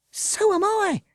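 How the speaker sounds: high loud gasping cry. Acted. Avril tripped by dogs, gasping cry. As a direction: blurt it out, breathless and alarmed. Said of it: "gasped Avril".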